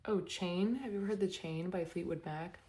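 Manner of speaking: ordinary talk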